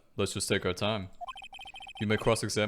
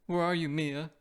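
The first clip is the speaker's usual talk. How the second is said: put-on higher, pleading young girl voice; 7 semitones higher, slower than usual. [name] calls out to her sister Mia, high pleading girl voice. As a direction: call out for a missing sister, pleading.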